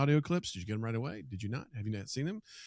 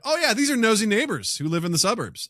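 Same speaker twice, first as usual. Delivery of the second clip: dawning realization; 9 semitones higher, 8 dB louder than usual. Retold or imitated. Past realization quoted.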